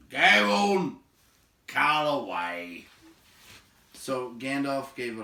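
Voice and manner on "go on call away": loud, slow, gruff go-ahead; gruff giant. A narrator's reading. Big man telling the wizard to call, loud gruff. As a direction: wave him on impatiently. Beron answers.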